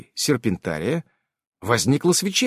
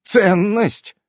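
high but fuller-voiced repeat of ЦЕННОСТЬ; emphatic echo; 6 semitones higher than usual. A narrator's reading. Irene repeats Marta's word while mixing, emphatic echo. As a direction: echo ЦЕННОСТЬ firmly, falling at the end.

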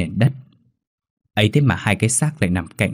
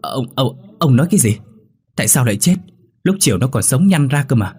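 frightened wife stammering, higher and louder; stammering in fear; 5 semitones higher, 3 dB louder than usual. Acted. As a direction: stutter in disbelief, voice pitched up. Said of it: "miệng cũng bắt đầu lắp bắp". (bà cả sợ hãi lắp bắp hỏi chồng)

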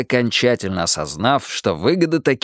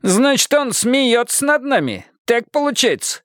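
indignant villager; 13 semitones higher than usual. A narrator's reading. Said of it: "предположил кто-то".

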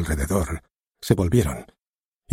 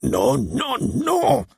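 building from high to a much higher shout; roaring no; much slower than usual. Acted. The director rejecting the actor's line, a building roar. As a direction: roar each no harder. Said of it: "rugió".

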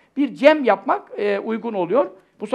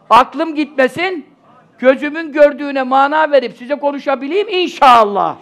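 at a pulpit microphone; 4 semitones higher, 9 dB louder than usual. Real, not acted. Loud prayerful wish to audience.

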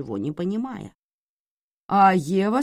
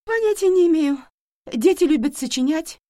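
nervous, much higher and louder; high-pitched voice; 13 semitones higher, 9 dB louder than usual. Acted. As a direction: voice jumps way up in panic. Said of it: "Её голос звучал на октаву выше".

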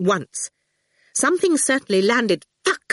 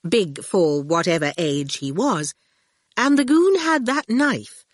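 usual speaking voice

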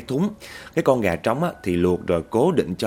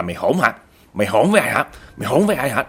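angry scolding; 4 semitones higher, 5 dB louder, faster than usual. Acted. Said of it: "quan tức lắm".

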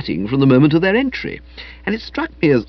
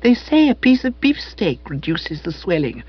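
put-on higher, soppy young woman; Madeline's voice; 6 semitones higher than usual. A narrator's reading. Madeline advising Spode about his eye, high and cooing. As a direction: coo it sweetly, up high.

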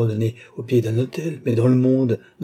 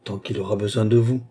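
ordinary delivery, nothing marked

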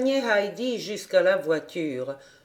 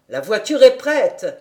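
louder shout from downstairs; called from below; 2 semitones higher, 8 dB louder than usual. A narrator's reading. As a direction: call up the stairs. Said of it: "on cria d'en bas". (cri d'en bas, voix forte)

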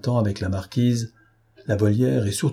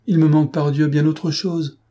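agitated exclamation; 6 semitones higher, 3 dB louder than usual. Acted. Robbed financier exclaims to marquise.

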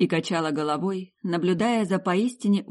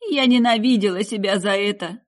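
louder, a mild tearful outburst; through sobs; 4 semitones higher, 6 dB louder than usual. Acted. Mother confessing to her son, mildly tearful. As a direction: confess it as if sobbing, understated. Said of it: "сквозь всхлипы".

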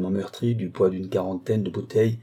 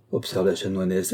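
ordinary delivery

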